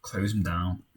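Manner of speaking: ordinary talk, nothing marked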